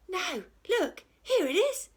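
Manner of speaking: very high pirate voice; a pirate gleefully finding the chest; pirate Peg Polkadot. A narrator's reading. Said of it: "said Peg Polkadot".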